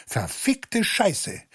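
herausplatzender Fluch. Acted. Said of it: "so brach es auch aus".

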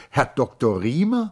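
high, loud, incredulous Herr Doktor Riemer; agitated lady. Acted. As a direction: echo the name in alarm. Charlotte repeats name, agitated. (empört hoch und laut: Herr Doktor Riemer?)

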